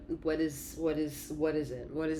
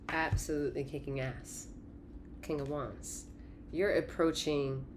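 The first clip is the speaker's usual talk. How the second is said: usual speaking voice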